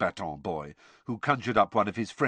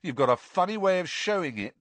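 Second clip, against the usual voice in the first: higher-pitched sneer; 8 semitones higher than usual. A narrator's reading. Ron doubting Hermione's loyalty to Harry, sneering. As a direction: sneer it as a taunt. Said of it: "sneered Ron".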